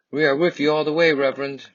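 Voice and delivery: rally behind your leader with fast, eager energy; a supporter eagerly cheering on the reverend; excited supporter. Acted.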